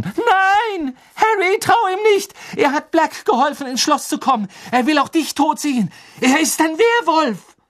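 sehr hoch und laut geschrien; Harry panisch warnen; panische Warnung. A narrator's reading. Hermine warnt Harry schreiend. (Hermine schreit Harry eine panische Warnung zu)

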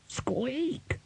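tiny, very high soft squeak; rat squeak. A narrator's reading. Narrator voices the rat.

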